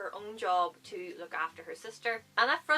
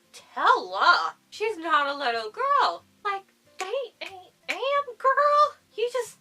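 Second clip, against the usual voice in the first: loud, high-pitched mock outrage, climbing to a squeal; astonished outburst; 12 semitones higher, 12 dB louder, slower than usual. Reader reacting to Tella's change, escalating astonished outburst. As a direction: exclaim louder and higher until words fail you.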